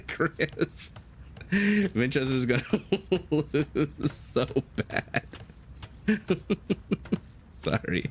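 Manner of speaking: high-pitched giggling through the words; can barely get it out for laughing, slowing right down. Real, not acted. Caster cracking up telling Chris the score, laughing hard.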